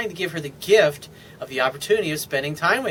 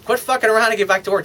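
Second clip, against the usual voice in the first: playful bossing; 7 semitones higher, 5 dB louder, faster than usual. Shown as a demonstration. Repeating the teasing tease.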